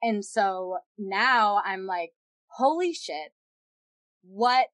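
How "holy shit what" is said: higher-pitched stunned disbelief; retold shock. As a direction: relive being stunned, voice jumping up. Retelling her shocked, helpless reaction.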